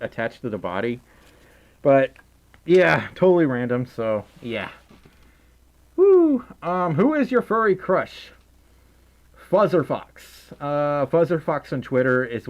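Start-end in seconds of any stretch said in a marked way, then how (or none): 6.0-6.4 high, loud woo; whoop. Real, not acted. Streamer lets out a loud high woo between questions.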